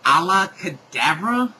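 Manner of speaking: loud, rising baffled question; puzzled echo, rising at the end; incredulous query. Real, not acted. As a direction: question the words in disbelief. Reader queries the misspelt spell.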